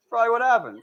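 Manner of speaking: usual speaking voice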